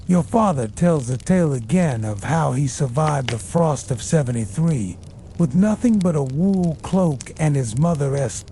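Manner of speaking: ordinary delivery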